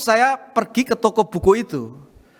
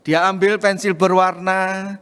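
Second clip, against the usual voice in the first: normal talking voice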